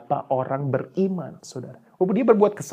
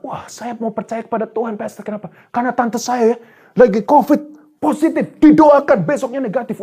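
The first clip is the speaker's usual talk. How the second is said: high, eager believer's voice, louder toward the end; excited testimony; 8 semitones higher, 6 dB louder than usual. Acted. Believer telling the pastor about a healed aunt, excited. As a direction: act out an amazed believer, pitched high.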